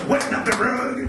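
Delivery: put-on Redd Foxx grumble; a touch of Redd Foxx; Redd Foxx impression. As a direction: grouse like Redd Foxx about the rug. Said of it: "Red Fox would be like".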